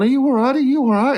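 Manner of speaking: high, fussing mock concern; imitated concerned onlookers. Onlookers check on crying mum, high mock concern. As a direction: ask it high, like a flustered bystander. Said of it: "Everyone's going".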